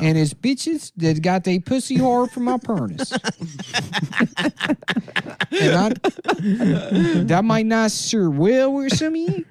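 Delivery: high-pitched mock Taiwanese accent; hammed-up Taiwanese accent, pitched well up; put-on Taiwanese accent. Candidate addressing the debate audience, put-on Taiwanese accent. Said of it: "where did you pick this accent up".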